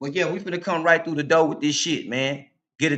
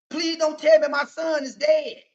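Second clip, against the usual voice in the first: pleading cry; 13 semitones higher, 3 dB louder than usual. Acted. Mother begs about her son.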